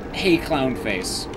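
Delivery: ordinary delivery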